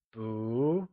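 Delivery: "bu" sliding steadily upward; mid-rising tone. Shown as a demonstration. Teacher showing learners how to pronounce it, "bu" in a mid-rising tone. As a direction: draw out "bu" with a rising pitch. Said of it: "said with a mid-rising tone".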